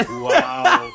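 mock amazed, high loud laugh; sarcastic wow. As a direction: fake awe, cracking up.

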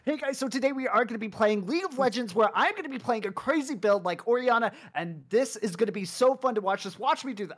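high, loud, hyped gaming host; YouTube intro voice. Pretend. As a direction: sell the video like a streamer. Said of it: "I'd be like".

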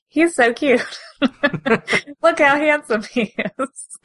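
high, adoring squeal through laughter; giggly fangirl gushing. Acted. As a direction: gush over your crush, giggling. Gushing over favourite character, laughing.

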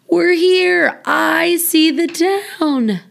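put-on high, loud excited child calling out; a little girl thrilled to arrive. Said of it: "Betty said".

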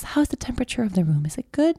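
a hint of a hushed spa therapist; mild mock soothing masseur voice; massage voice. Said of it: "he has this kind of massage voice".

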